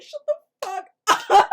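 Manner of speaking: put-on high, very loud outburst at the kid; exasperated shout. Viewer to the boy who yells during the escape, exasperated shout. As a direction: yell it in mock fury.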